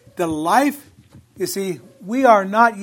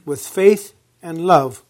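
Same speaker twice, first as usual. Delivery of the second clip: measured, ringing Bible reading; proclaiming scripture from the pulpit; 5 dB louder than usual. Acted. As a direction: read the verse out to the congregation. Reads scripture aloud.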